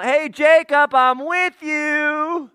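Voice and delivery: high, drawn-out far-calling shout; yelling down. Acted. God at the top of the ladder calling to Jacob, yelling down. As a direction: holler it down from way up high. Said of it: "yelling down".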